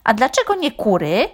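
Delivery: offended hen voice; indignant hen. A narrator's reading. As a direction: protest like a slighted hen. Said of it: "oburzyła się gdaczka".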